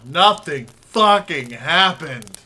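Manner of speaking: slow, loud, drawn-out disgust; loud exaggerated complaint. Real, not acted. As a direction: hammer each word out in protest. Exaggerated complaint about the movie.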